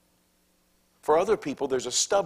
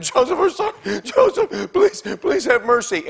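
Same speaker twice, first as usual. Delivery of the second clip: high, loud, desperate begging; pleading brothers; 8 dB louder than usual. Acted. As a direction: cry out for mercy, much higher. Brothers beg Joseph on faces.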